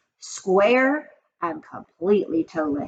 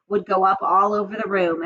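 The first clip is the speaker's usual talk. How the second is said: ordinary delivery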